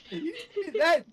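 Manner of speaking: high, squeaky laughing protest. Real, not acted. Catching the friend's accent slip, laughing hard.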